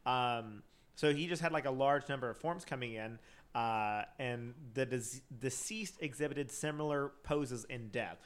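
ordinary talk

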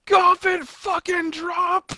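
drawn-out high yell of a wrestling move; excited shout. Real, not acted. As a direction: call it out like an announcer. Wrestling call-out while gaming.

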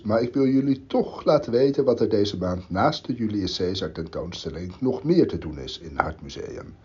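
normal talking voice

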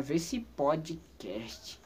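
innovated catchphrase. Pretend. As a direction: stretch "podcast" into a long, lingering pun. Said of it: "estou inovando".

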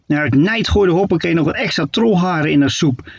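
usual speaking voice

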